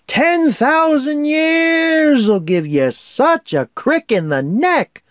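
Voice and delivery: high, slow, stretched cartoon Genie; high slow cartoon genie complaint. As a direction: groan it out like a stiff-necked genie. Quoting Genie's famous line.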